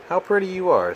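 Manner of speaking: high-pitched, bright praise; a crab charmed by coral; delighted high admiring crab. Acted. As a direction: gush with wonder.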